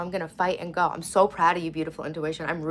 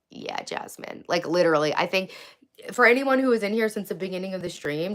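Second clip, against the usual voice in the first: usual speaking voice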